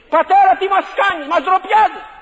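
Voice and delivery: high, loud pleading cry to a shamed father; children crying out. Retold or imitated. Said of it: "οι φίλοι όλοι φωνάζουν".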